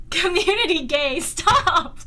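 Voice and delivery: normal talking voice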